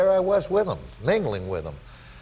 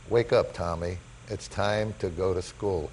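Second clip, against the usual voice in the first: soft, slow, low-key mother's words; gentle wake-up call; 4 semitones lower, 5 dB quieter than usual. Retold or imitated. Mother waking him from his Hall of Fame dream, gentle and slow. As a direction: ease it out softly and slowly.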